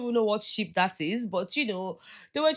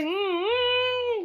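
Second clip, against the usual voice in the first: mock whine. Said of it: "I say".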